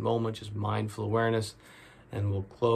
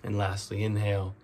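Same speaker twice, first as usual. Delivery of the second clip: guided meditation; 7 dB quieter than usual. Real, not acted. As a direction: ease them into each breath, drawing out the key words.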